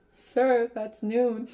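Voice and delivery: small, high-pitched timid-sailor voice; high little voice; imitating little voice. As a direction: pipe up like a little midshipman. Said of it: "[name]'s little voice go".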